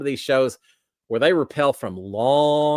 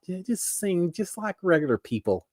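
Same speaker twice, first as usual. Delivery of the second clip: imitating wife; 6 semitones higher, 4 dB quieter than usual. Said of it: "Sharon's always like".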